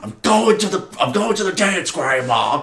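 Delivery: high-pitched exasperated call back to grandma; mock-whiny grandson impression; Biff. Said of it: "Biff comes out".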